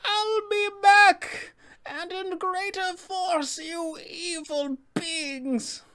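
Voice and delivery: very high, drawn-out witch calling out; Baba Lysaga. Acted. Fleeing witch calls out to players, high slow vow. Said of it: "then she will call out to them".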